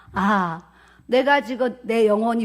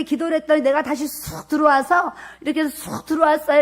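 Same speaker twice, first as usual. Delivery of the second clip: higher, urgent call to a husband; urgent retelling; 4 semitones higher, 4 dB louder than usual. Retold or imitated. Wife tells husband she died and revived, urgent excitement. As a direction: blurt out the astonishing experience.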